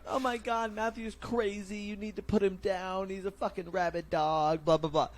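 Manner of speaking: high, hammed-up voice mocking the gossips. Mocking the people who say he's crazy, high hammed-up whine.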